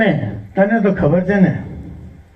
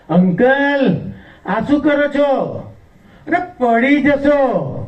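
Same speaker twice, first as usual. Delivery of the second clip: high-pitched voice warning an uncle; put-on high voice of alarmed concern; concerned woman; 7 semitones higher than usual. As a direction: pitch it up and stretch the first word. Acting the woman warning him.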